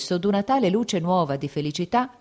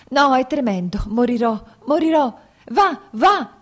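much higher, louder, rising panic; terrified scream; 7 semitones higher, 7 dB louder than usual. A narrator's reading. Kitty screams at Lievin in terror, much higher and louder. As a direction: cry out in terror as the pain takes her. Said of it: "Ella gridò".

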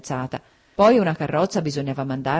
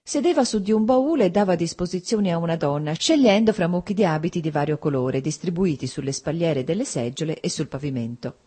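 ordinary delivery, nothing marked

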